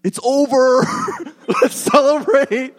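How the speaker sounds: high, loud hammed-up cheer with a laugh; mock cheering. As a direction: ham up a giddy victory party. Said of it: "Are they like".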